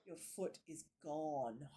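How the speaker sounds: ordinary talk, nothing marked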